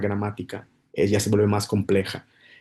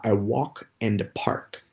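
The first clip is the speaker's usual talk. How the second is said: "a walk in the park" said very slowly and softer; slow idiom demo; 4 dB quieter, much slower than usual. Shown as a demonstration. Teacher slowly models the idiom "a walk in the park" for learners. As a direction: lay out "a walk in the park" gently, word by word. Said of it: "otro idioma muy común es decir".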